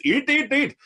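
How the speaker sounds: put-on high-pitched, insistent Sri Lankan accent. Mother-in-law urging food at the table, Sri Lankan accent. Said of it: "I'll say in that accent".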